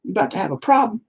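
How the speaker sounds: mock tough guy. Defying soldiers at his house, in a squeaky mock-tough voice. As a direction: pipe it up high like a scrawny punk acting tough. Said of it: "I'd be like".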